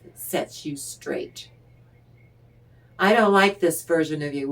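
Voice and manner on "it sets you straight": whisper. Real, not acted. Tarot reader's aside after quarrel.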